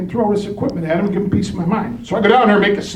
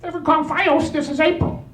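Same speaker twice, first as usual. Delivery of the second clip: put-on a poor Indian accent, pitched higher; admittedly bad Indian accent for the saleswoman; lightly done Indian accent; 9 semitones higher than usual. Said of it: "With an Indian accent that I suck at".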